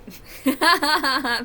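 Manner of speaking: a sudden loud laugh at her own joke; loud burst of laughter. Real, not acted. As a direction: break into a big laugh. Laughs at own joke about dog.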